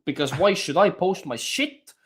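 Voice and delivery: normal talking voice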